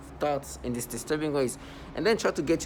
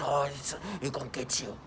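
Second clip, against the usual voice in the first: mock mean voice; 4 dB quieter than usual. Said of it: "continue to use this mean voice".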